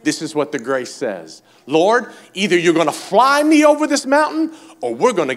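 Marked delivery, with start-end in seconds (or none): 1.6-5.4 loud, high challenge to heaven; bold preached prayer. Acted. Believer telling the Lord about the mountain, bold preached prayer. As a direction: declare it boldly at preaching pitch.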